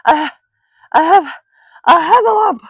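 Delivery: high, loud, broken false starts; breathless stammer. A narrator's reading. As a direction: struggle to get the word out, panting. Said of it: "breathing so hard that he could hardly speak".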